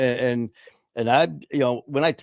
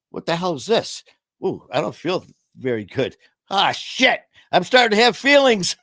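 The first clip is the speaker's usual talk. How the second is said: mock alarm; 6 semitones higher, 5 dB louder than usual. Acting out sudden feelings.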